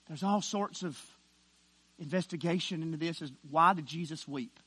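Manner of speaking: ordinary talk, nothing marked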